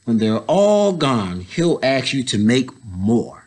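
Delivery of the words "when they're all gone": usual speaking voice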